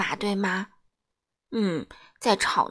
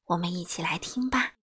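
ordinary delivery

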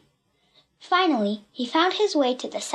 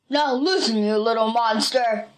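restrained villain's shout in a put-on voice; menacing shout. A narrator's reading. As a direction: threaten like a story bully. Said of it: "he shouted to Pee-Pee".

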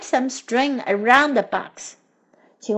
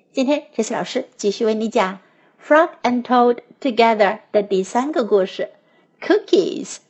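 ordinary delivery, nothing marked

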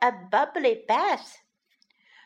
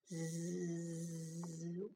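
soft snore; 6 semitones lower, 18 dB quieter than usual. Acted. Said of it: "went little bunny".

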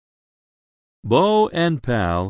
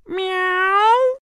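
very high kitty meow; cat meow. A narrator's reading. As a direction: mew like a cat. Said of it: "It says".

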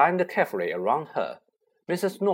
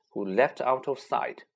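usual speaking voice

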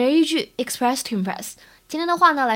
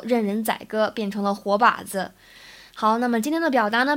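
ordinary talk

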